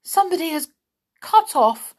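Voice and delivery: high, loud cry of dismay; loud, high-pitched horrified exclamation. A narrator's reading. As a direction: cry out aghast at the discovery. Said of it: "groaned Binky".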